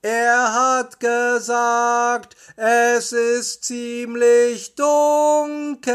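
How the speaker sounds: very slow, high, stretched call; calling an answer. A narrator's reading. Justus relaying Bob's words to Peter, drawn-out calling. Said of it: "Statt Bob antwortete Justus".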